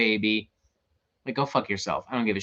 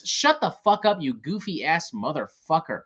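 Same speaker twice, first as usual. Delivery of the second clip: normal talking voice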